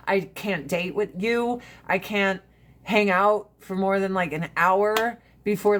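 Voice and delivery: normal talking voice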